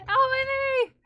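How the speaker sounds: high loud yelp of pain; banged knee yelp. Real, not acted. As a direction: yelp it out, really hurting. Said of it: "I ran my knee into my door so hard".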